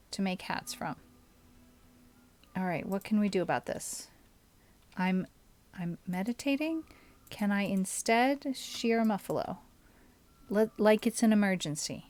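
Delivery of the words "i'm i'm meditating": normal talking voice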